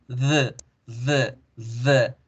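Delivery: "the" as a voiced th plus uh; tongue on the teeth for "the", schwa after; dental D. Shown as a demonstration. Teacher isolates the delta sound, dental D. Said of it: "la D dental".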